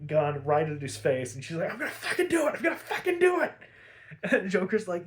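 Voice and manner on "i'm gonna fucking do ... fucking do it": very high, squeaky mock hysteria; hysterical threat. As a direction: imitate a frantic Harley, voice pitched way up. Harley threatens Joker with gun.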